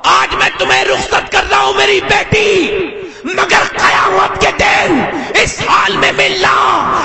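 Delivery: high, slow, drawn-out declamation; preaching at full force. Acted. Preacher retells a father's farewell to his daughter, high impassioned declamation. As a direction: stretch the farewell out high for the crowd.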